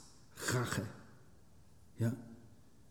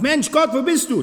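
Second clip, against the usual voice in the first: high, loud imitated plea; mock cry to God; 13 semitones higher, 8 dB louder than usual. Preacher imitating a half-hearted prayer, high loud mock cry. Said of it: "Das ist kein Schreien".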